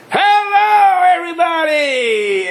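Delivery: high, long-drawn hollered greeting; rowdy drunk's high-pitched yell; drunken yell. Retold or imitated. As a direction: burst in hollering, stretching the words out. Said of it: "Suddenly he bursts in and yells".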